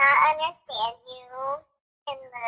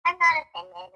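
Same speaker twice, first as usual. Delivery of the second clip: usual speaking voice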